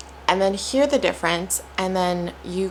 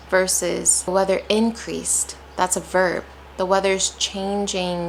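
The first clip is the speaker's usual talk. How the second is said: ordinary talk, nothing marked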